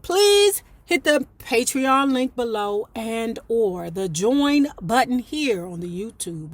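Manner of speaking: normal talking voice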